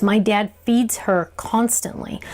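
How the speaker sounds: ordinary delivery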